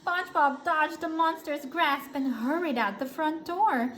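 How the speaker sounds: usual speaking voice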